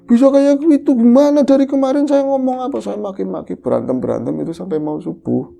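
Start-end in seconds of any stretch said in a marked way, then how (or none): 0.1-2.8 angry scolding. Retold or imitated. Wife scolding her husband.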